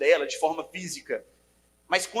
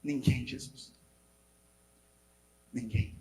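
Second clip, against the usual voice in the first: low, much softer answer; faint soft reply; 6 semitones lower, 10 dB quieter, slower than usual. Retold or imitated. The woman answering Jesus on the ground, soft and low. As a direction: barely voice the answer. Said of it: "Jesus fala com ela".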